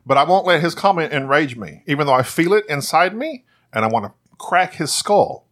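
normal talking voice

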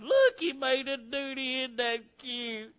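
high coo, slowing at the end; doting new parent. Acted. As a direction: coo over the baby in mock adoration. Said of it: "first love for your child".

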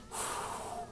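blowing air out to hold back tears; demonstrated long puffing breath; puffed-cheek exhale. Shown as a demonstration. Boy fighting back tears in the kitchen, puffed exhale. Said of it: "I'm breathing, like, air in my jaws".

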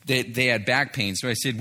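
usual speaking voice

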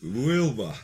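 put-on hammy Russian accent reading a line. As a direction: ham up a Russian accent with a laugh. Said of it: "first one for Adam was Russian".

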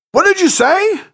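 can't believe what he just heard; astonished outraged yelp. A narrator's reading. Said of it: "replied an astonished Harry".

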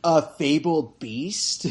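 very slow, mock-grand pronouncement; slow grand line. Friend quoting the movie, slow mock-grand. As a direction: intone it like a legend. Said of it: "he's like".

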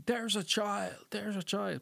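retold urgent cry; calling out. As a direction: call it out as if spotting a child. Acting out man's urgent call.

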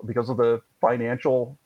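usual speaking voice

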